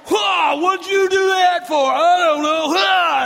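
high, loud mock panic; panicked yelling. Philistines as the ground shakes, panicked yelling. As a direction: yell in hammed-up alarm and confusion.

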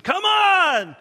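loud, high shout of an impatient driver; impatient yell. Retold or imitated. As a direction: yell it like a honking driver in a hurry. Imagined honking drivers yelling.

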